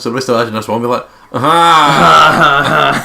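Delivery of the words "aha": loud, high Scottish Ned cackle. Retold or imitated. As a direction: blast out a Ned laugh. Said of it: "it's like the Ned one".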